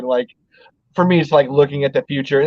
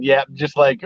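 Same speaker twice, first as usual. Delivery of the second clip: usual speaking voice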